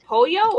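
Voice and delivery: "pollo" said Spanish-style, POH-yo; L's as Y. Shown as a demonstration. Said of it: "or do they say the L's".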